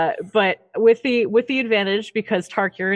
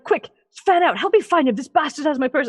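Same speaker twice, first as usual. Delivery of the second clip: guard giving orders; 8 semitones higher than usual. Acted. Guard ordering his men to search for the thief. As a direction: bark at your men to spread out. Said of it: "he's like".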